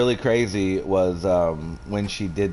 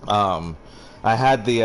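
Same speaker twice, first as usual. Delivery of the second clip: ordinary talk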